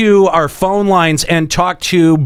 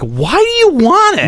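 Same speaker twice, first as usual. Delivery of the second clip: high-pitched, incredulous quoted comeback; exasperated retort; 10 semitones higher than usual. Retold or imitated. Host retorting to anyone suggesting swimming, exasperated. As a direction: throw it out as an exasperated, high question. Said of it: "I'm like".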